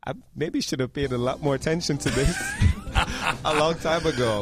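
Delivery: laughing. Real, not acted. Guest admits he ignored the podcast.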